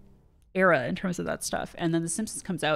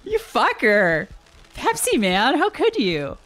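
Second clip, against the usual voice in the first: higher, louder hammed-up betrayal; mock outrage; 8 semitones higher, 5 dB louder, slower than usual. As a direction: accuse him dramatically. Mock outrage at game enemy.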